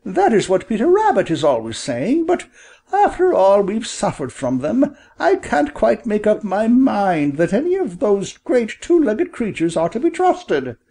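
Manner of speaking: high-pitched little mother quail; high storybook bird voice, doubting; mother bird, doubtful. Acted.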